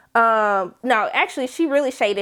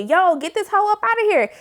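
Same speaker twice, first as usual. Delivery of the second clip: sassy high diva voice; sassy Marie; 8 semitones higher than usual. Pretend. Marie telling Cordelia to get him out, sassy. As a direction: boss her out of the room. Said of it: "She didn't say that".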